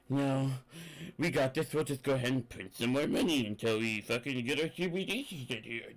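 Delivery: smug, offhand mock official voice; mock Federal Reserve. Federal Reserve waving off a question about bonds, smug mock voice. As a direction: brush it off like a smug central banker.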